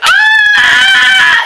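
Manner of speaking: excited yell in the cab; loud high excited cry; shout. Retold or imitated. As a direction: shout it out in shock. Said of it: "I was shouting like".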